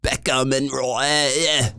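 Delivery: slow, lazy put-on English accent; caricatured English accent, cocky; mock English accent. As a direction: play a bluffing English coach, English accent. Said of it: "listening to people that".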